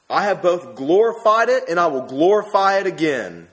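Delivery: booming voice of God from heaven. Acted. God answering Jesus from heaven, booming. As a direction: proclaim it like God from the sky. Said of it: "came there a voice from heaven saying".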